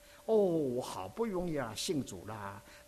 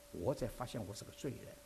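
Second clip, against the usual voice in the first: hushed realisation; 3 semitones lower, 6 dB quieter than usual. Acted. Refrain of realisation.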